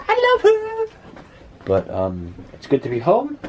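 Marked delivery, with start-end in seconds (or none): 0.1-1.2 very high, drawn-out squeal; gushing affection. Real, not acted. Husband gushing about Jackie folding the clothes, affectionate squeal. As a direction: gush it out slowly, way up high.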